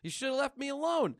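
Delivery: a hint of a scared kid; frustrated girl.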